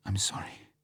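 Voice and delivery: tearful whispered apology; muffled whisper. A narrator's reading. Vesper apologising to Bond through tears, whispered. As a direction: breathe it out, almost crying. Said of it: "she said in a muffled voice".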